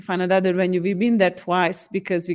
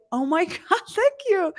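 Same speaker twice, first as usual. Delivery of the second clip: grateful client; 12 semitones higher than usual. Retold or imitated. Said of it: "And they're like".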